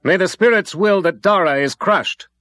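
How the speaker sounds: high, loud beseeching plea; fervent pleading. A narrator's reading. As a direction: beg the spirits fiercely. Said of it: "beseeched the man".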